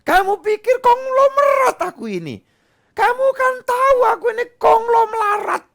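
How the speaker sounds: high, loud mocking parent voice; sarcastic scolding parent. Parent mocks child who asks for expensive things, high and sarcastic. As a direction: scoff at the kid's demands. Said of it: "nyumpahin diri sendiri".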